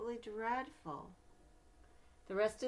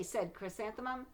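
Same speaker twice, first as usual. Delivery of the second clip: storybook narration, gentle and lively; reading to children; 3 dB louder, slower than usual. Acted. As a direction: read it like a bedtime picture book. Reads picture book aloud.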